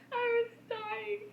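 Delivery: high, breathless laughing; laughing so hard it squeaks; laughter nearly turning to tears; crying with laughter. Real, not acted. Helpless laughter at story.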